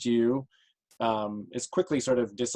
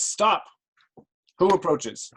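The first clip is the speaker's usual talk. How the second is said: higher-pitched guard calling out a challenge; challenging sentry; 7 semitones higher, 4 dB louder, slower than usual. Acted. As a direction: call out to halt a stranger. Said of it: "one of them, uh, says".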